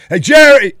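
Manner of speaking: loud, high-pitched holler; sudden full-voiced yell; calling out. Real, not acted. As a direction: shout a name to someone across the way. Calling out to someone not there.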